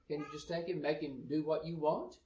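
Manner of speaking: ordinary delivery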